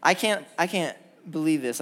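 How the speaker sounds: usual speaking voice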